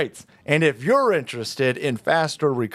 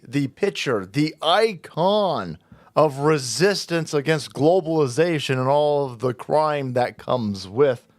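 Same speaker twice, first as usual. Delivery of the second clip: usual speaking voice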